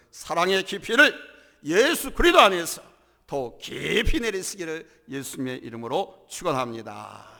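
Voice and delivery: ordinary delivery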